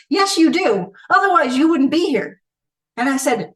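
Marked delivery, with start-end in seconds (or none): 0.1-2.4 higher and louder, flatly certain; insistent inner voice. Retold or imitated. Her inner self insisting to her, firm and certain. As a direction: press the truth home.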